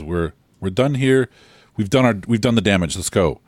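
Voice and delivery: put-on Middle Eastern accent. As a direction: urge them on in a light Middle Eastern accent. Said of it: "a Middle Eastern accent".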